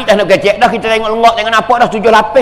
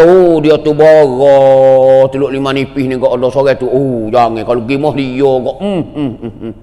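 acted grumbling quote; 7 semitones lower than usual. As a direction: draw the words out low, trailing into hums. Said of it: "Dia kata".

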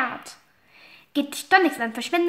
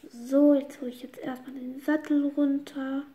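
ordinary talk

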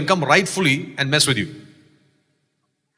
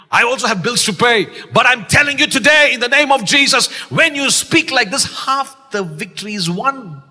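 higher and louder, growing; building preaching; 5 semitones higher, 4 dB louder than usual. Real, not acted. Preacher rallying the church, building to a shout. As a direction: build it up to a cry.

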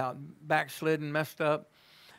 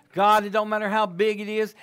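ordinary talk

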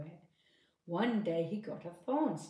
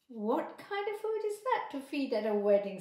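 tutting; 6 semitones higher, 6 dB louder than usual. Acted.

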